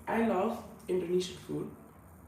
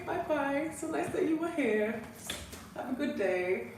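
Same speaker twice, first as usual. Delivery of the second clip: high sweet goodbye; 9 semitones higher than usual. Real, not acted. Saying goodbye to stream viewer.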